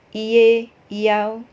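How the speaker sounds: "iye" and "iau" split with a separate i; wrong reading. Shown as a demonstration. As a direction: demonstrate the mistaken split. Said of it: "bukan iya". (contoh lafal yang salah)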